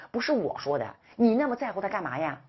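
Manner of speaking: usual speaking voice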